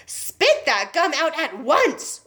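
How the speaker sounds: slow, firm command; a father ordering his child; stern order. A narrator's reading. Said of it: "ordered Mister Beauregard".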